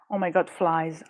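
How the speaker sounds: ordinary delivery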